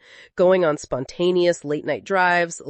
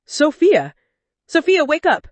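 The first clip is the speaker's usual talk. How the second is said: much higher, louder cries of panic; panicked shout; 9 semitones higher, 6 dB louder than usual. Acted. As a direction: yell her name to wake her. Husband finds wife collapsed.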